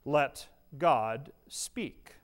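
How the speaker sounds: very slow, each word spaced; slow emphasis. Shown as a demonstration. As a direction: let God speak, drawn out. Pastor stressing the key phrase.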